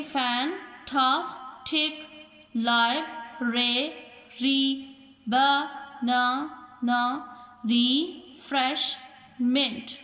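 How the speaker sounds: each three-syllable word in separate beats, like फैन, टॉस, टिक; teacher sounding out रिफ्रेशमेंट as री, फ्रेश, मेंट; split into syllables. Shown as a demonstration. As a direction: pause after every syllable, as in ब, न, न. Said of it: "वो words जो तीन".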